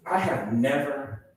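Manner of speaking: normal talking voice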